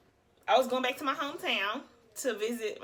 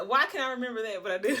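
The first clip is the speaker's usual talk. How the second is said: ordinary talk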